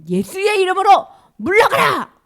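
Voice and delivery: high, loud rebuking yell; acted shout. Jesus driving off whispering Satan, commanding shout. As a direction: shout the demon away with full force. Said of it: "소리 질러주는 거예요".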